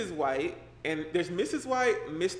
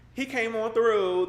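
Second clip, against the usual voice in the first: slow, higher and louder, a mock spooky tone; 6 semitones higher, 6 dB louder, slower than usual. Shown as a demonstration. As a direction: play up the ghost story. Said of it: "I'm gonna put on the spooky voice".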